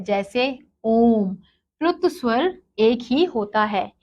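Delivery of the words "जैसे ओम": ओम drawn out very long and slow; prolonged vowel. Shown as a demonstration. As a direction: hold the O of ओम three times as long. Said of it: "तिगुना समय लगता है". (ओम को बहुत लंबा खींचकर)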